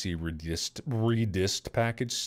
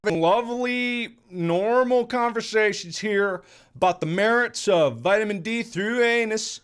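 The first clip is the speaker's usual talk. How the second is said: much higher and louder, hammed-up indignation; mock outrage; 12 semitones higher, 7 dB louder than usual. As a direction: play the offended host, over the top.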